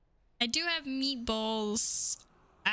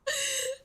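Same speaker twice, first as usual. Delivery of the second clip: high squeal laugh. Real, not acted. Surprised by donation.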